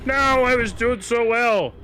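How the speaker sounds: high, loud anguished No; dismayed cry. Real, not acted. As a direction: cry out as the run ends. Killed in game.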